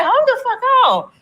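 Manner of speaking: mock-outraged disbelief; stunned hold on. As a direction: freeze at the sofa bed popping out. Couch turns into a bed.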